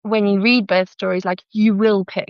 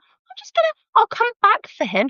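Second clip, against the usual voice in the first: chirpy porter; 14 semitones higher than usual. Retold or imitated. Brisk porter retold.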